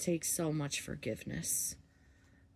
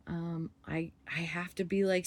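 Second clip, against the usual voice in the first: ordinary talk, nothing marked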